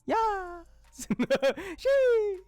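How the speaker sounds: very high, loud excited squeal; cheer. Real, not acted. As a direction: squeal with delight. Cheering with a laugh.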